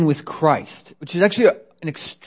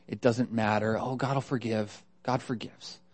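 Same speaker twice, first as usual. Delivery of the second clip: much softer, offhand dismissal; complacent shrug; 4 semitones lower, 11 dB quieter than usual. Acted. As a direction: toss it off quietly, as no big deal. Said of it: "we don't think it's a big deal".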